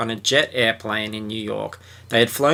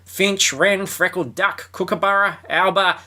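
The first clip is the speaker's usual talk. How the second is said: Jake reading the chart; 7 semitones higher than usual. Acted. Jake reads bird chart.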